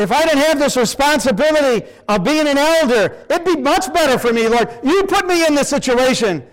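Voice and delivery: high, loud whining excuse; hammed-up whine pinning the blame on God; whiny blaming. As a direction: complain to God like a self-pitying man. Man blaming God, acted prayer.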